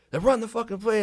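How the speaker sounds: loud, high retold order; imitated exasperated coach. Coach overrides arguing player, imitated shouted order. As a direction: override the argument like a fed-up coach.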